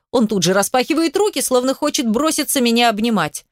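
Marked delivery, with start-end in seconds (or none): none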